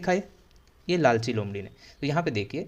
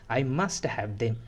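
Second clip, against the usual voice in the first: slow model reading; 4 semitones lower, much slower than usual. Shown as a demonstration. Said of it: "teacher reads fox's line".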